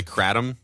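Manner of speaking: Kratom as "KRAT-um"; KRAT-um for Kratom, stressed first; alternative pronunciation. Shown as a demonstration. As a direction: say Kratom with the short "a" of cat. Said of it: "a lot of people say Kratom".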